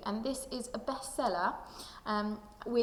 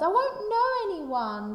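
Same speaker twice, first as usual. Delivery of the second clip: upset yell; 9 semitones higher, 9 dB louder, slower than usual. Acted. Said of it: "I cried and I yelled".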